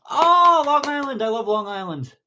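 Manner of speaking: big high, loud "oh", easing down; real excitement, starting high and settling; gushing delight. Real, not acted. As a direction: gush over a favorite place. Gushes on hearing of Long Island.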